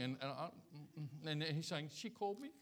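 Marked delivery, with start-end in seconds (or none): 1.9-2.5 soft, high, tearful mimic of a crying man; hint at the sob without overdoing it; imitating crying. Crying man repeating what his girlfriend did, soft tearful imitation. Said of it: "he's crying".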